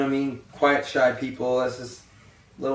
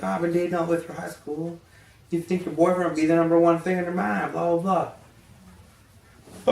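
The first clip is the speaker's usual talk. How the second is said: lightly raised, mocking whiny girl; mocking girly voice; 6 semitones higher than usual. Vlogger mocking a woman who expects to come first, lightly mocking voice. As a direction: toss it off like a silly girlfriend.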